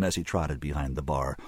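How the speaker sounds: usual speaking voice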